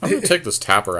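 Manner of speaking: normal talking voice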